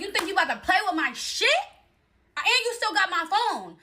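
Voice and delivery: high, loud and outraged; indignant confrontation. Retold or imitated. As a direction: call him out, pitch jumping up. Acted confrontation over her stuff.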